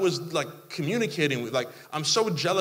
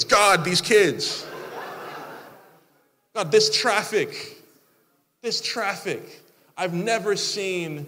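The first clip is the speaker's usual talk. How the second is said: slow, higher, louder groan; playful whine about life's annoyances; mock exasperated complaint; 5 semitones higher, 4 dB louder, slower than usual. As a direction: sigh it out at God, hammed up.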